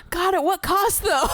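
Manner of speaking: high, put-on dramatic sigh of a voice; mock exasperation. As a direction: lament it like a weary aristocrat. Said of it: "that I'm just like".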